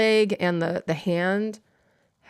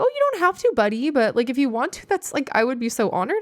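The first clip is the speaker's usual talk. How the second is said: much higher, touched and gushing to a student; humbly flustered at a gift offer; high flustered thanks retold; flustered delight; 5 semitones higher, faster than usual. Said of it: "And I was like".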